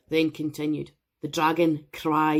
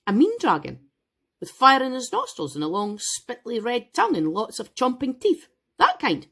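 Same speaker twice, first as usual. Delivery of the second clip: higher, teasing mother building the picture; playful mom voice; 7 semitones higher than usual. Acted. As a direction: ham up the scary details for your child. Go Mama voiced in story.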